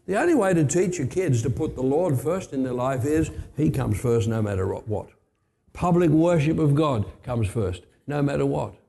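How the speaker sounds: usual speaking voice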